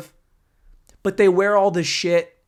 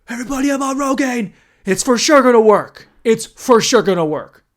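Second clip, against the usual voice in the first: loud overconfident announcement, higher voice; hammed-up bragging to everybody; mock cocky bragging; 7 semitones higher, 9 dB louder than usual. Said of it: "and then I'm like".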